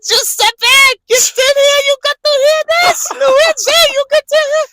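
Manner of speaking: very high, loud call to the kitchen; shouting waiter. Retold or imitated. The waiter calls Giuseppe and Luigi out, very high and loud. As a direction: yell for your coworkers, delighted. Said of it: "He's like".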